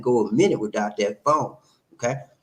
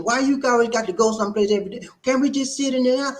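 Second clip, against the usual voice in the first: imitating wife; 11 semitones higher than usual. Husband mimicking his wife begging to stay in, high pitched. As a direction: play the wife pleading to stay home. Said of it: "She said".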